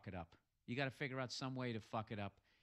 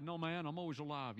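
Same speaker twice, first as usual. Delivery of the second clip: lightly voiced [name], somewhat raised; [name] impersonator playing along; 5 semitones higher, 4 dB louder than usual. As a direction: play along as a mock [name]. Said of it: "He's just playing along".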